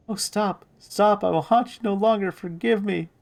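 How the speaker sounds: high-pitched mock begging for mercy; pleading corpse. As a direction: beg in a high, panicked put-on voice. Thrown corpse voice begs for mercy.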